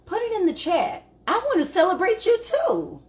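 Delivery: high-pitched, eager invitation; rally the viewers, voice lifted high; excited encouragement. Real, not acted. Host asking viewers to put it in the chat, excited.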